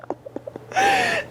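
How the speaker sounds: little high-pitched giggle. A narrator's reading. Jilly Boo laughing at nude noodles, high giggle. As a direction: giggle like a tickled kitten.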